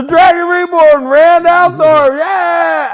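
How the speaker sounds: high, loud triumphant hail; excited cheer. Retold or imitated. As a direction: cheer it like a crowd. Crowd cheering for the Dragon.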